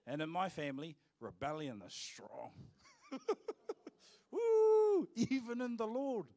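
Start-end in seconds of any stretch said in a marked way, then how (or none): 4.3-5.1 loud high whoop; real whoop of delight; excited whoop. Real, not acted. Cheer after rebellion joke. 5.2-6.3 higher and louder, riding the cheer; excited emphasis. Real, not acted. Excited punchline after whoop.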